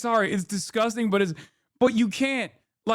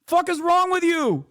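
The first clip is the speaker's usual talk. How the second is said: high-pitched indignant rebuke; incredulous, pitched-up outrage; exasperated disbelief; 7 semitones higher than usual. Acted. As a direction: scold them in sheer disbelief. Host berates agent in clip.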